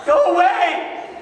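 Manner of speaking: high, loud ghost-scream breaking into a laugh; panicked shriek. Acted. Disciples screaming at a figure on the lake, high panicked shriek. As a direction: shriek it like you've seen a ghost.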